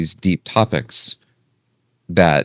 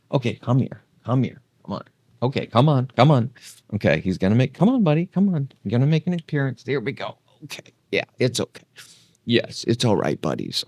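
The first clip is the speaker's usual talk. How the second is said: coaxing the dog; 6 semitones higher, faster than usual. Real, not acted. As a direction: gently coax the puppy out. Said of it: "It's the doggy voice".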